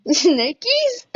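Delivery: high startled repeat; startled squeak. Pretend. Scared by snakes.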